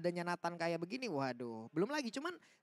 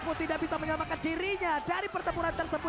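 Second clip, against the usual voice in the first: play-by-play commentary =29; 5 semitones higher, 5 dB louder than usual. Acted. Live esports play-by-play.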